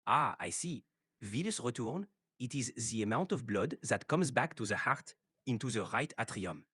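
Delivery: English with a strong French accent. Shown as a demonstration. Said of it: "in English with a French accent".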